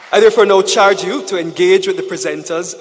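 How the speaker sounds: loud, ringing formal charge; rousing charge. Real, not acted. Speaker charging the conference delegates, loud and rousing. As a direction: proclaim it to the hall.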